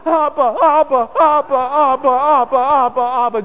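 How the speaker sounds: loud, high, repeated calls for Daddy; acted desperate child, high and loud; child crying out. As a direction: cry out urgently like a frantic toddler. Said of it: "and he goes".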